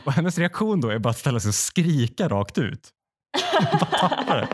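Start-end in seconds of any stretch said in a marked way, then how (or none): 3.3-4.5 laughing through the words, much higher; breaking up with laughter mid-line; cracking up at the absurd testing queue. Real, not acted. Laughing at her own story.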